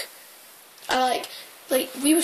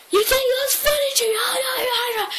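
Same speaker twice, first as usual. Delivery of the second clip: high, fast, furious shout; angry mum; 11 semitones higher than usual. Retold or imitated. Furious mum yelling at kids in the street, high and fast. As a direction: shriek it like a livid mum. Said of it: "the mum came out and she went".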